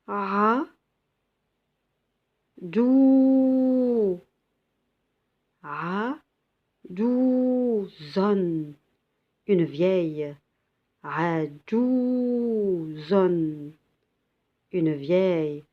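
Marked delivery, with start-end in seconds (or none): 0.1-8.7 ra dou zone, louder, each syllable set apart; spelling out syllables. Shown as a demonstration. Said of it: "teacher reads syllables". 11.0-13.7 ra dou zone, louder, each syllable set apart; spelling out syllables. Shown as a demonstration. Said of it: "teacher reads syllables".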